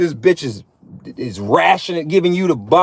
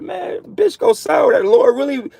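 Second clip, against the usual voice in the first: stretched-out high exclaim; mock preacher quote; 6 semitones higher than usual. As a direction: lift it up high like a revelation. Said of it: "We're like".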